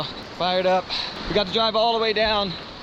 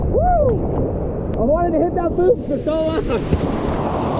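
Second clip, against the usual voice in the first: high, drawn-out excited holler; whoop, then cry it out slowly and high; elated whooping; 9 semitones higher, 4 dB louder, much slower than usual. Real, not acted. Kayaker finally hit the boof, elated whooping. Celebrates long-awaited boof.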